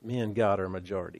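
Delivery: hushed, rapid affirmation; quiet, quick repeat. Shown as a demonstration. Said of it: "Say that".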